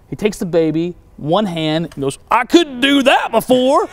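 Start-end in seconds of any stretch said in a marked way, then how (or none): 2.3-3.9 high, loud, slow amazed exclaim; high, loud, slow cry of wonder at his healed arm. Retold or imitated. Healed man lifting the baby.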